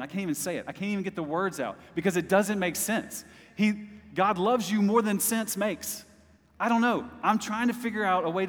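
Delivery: lifted, awed preaching; overwhelmed exclaim. Real, not acted. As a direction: burst out in wonder.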